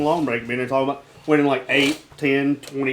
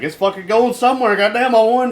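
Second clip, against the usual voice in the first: high, louder gleeful cheer; mock elation; 9 semitones higher, 7 dB louder than usual. Imitating himself cheering a casino win, high and gleeful. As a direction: celebrate a win, hamming it up.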